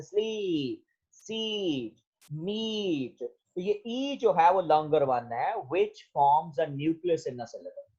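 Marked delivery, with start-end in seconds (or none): 0.0-3.2 स्लीप, सीट, मीट with the ee held long; softly drawn-out ee in स्लीप, सीट, मीट, each falling away; long vowel demo. Shown as a demonstration. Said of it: "longer one, जैसे".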